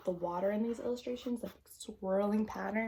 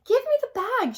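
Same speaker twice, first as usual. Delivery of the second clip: high, loud demand; crying out; 13 dB louder than usual. Acted. Girl struggling with the monkey for the bag, a high loud demand. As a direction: grab for it and yell. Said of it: "she cried".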